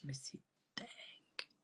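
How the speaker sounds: souffle, lent, à peine audible; lâcher l'indignation dans un souffle; indignation chuchotée. Retold or imitated. (indignation soufflée, lente)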